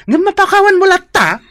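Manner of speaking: exasperated. Acted. Friend exasperated by forgiveness.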